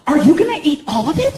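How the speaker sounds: put-on high-pitched girlfriend interrogation. Girlfriend challenging him over cakes, high-pitched. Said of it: "and she's like".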